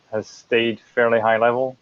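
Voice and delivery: ordinary delivery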